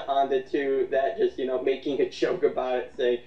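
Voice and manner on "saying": ordinary talk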